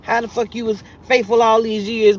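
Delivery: ordinary talk, nothing marked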